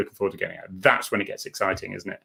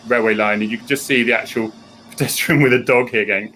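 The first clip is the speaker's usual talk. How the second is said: ordinary delivery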